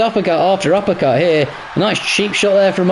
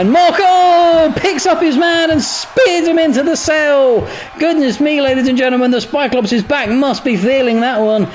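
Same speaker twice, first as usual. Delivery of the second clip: excited, higher play-by-play; wrestling commentator; 6 semitones higher than usual. Acted. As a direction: call the spear like a hyped ringside announcer. Climactic spear call.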